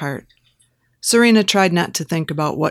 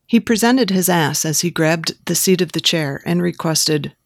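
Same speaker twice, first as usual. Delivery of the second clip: ordinary delivery, nothing marked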